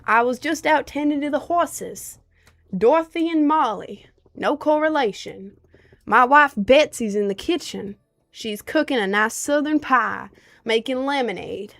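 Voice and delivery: put-on Southern accent, rancher from the ranch. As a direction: play a Southern rancher greeting the chat. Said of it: "Do your southern accent".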